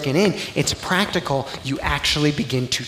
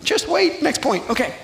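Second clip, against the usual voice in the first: playful high teasing; 10 semitones higher than usual. Acted. Pastor to congregation, playfully high and teasing. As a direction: pitch jumps high, teasing the crowd.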